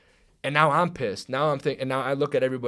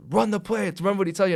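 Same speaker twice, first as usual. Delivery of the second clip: loud, high retold order; imitated coach's shout; 9 semitones higher, 6 dB louder, faster than usual. Other coach orders player to run play, imitated shout. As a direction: holler it like a coach across the field.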